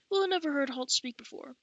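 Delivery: ordinary delivery, nothing marked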